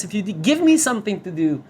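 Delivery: ordinary talk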